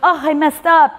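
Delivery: high, put-on voice of a flustered client; mock dismay. Client fretting over her drawing mistake, mock dismay.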